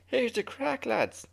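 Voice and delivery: high-pitched Irish impression; Irish accent. Shown as a demonstration. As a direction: do a confident, exaggerated Irish brogue. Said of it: "I can't do the Irish accent like you".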